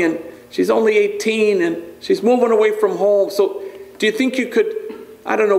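a hint of a fretful father asking; proud worried father. Bandit pleading about daughter.

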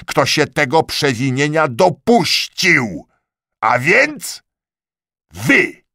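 rising from firm to loud, high pronouncement; building verdict. A narrator's reading.

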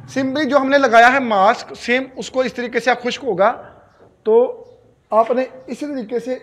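talking while peeling face mask; hand near mouth. Real, not acted. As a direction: fingers partly covering lips. Seen 1: Hands peel face mask near the mouth, partly covering it until 1.9 s.

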